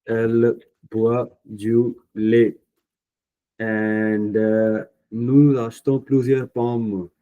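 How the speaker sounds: slow, each word laid out for students; slow dictation. Shown as a demonstration. Teacher to students, repeating the answer slowly, deliberately. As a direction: read it out slowly for the class to copy.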